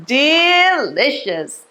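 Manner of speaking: slow, drawn-out first syllable; savouring. Pretend. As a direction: stretch "Delicious" as if relishing a drink. Savouring the pretend drink.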